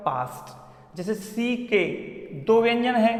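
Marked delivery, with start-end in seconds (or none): 0.1-1.0 पास्ट whispered, lower and much softer; whispered pronunciation. Shown as a demonstration. Teacher softly models पास्ट for students. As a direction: breathe पास्ट out quietly, ending on t. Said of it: "तो हम इसको क्या बोलेंगे".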